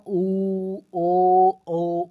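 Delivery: ऊ and ओ held long with rounded lips; rounded vowels. Shown as a demonstration. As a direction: sustain each vowel, lips in a circle. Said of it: "पूरी तरह से गोलाकार बन रहा". (होंठ गोल करके ऊ, ओ)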